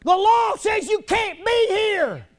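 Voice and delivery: normal talking voice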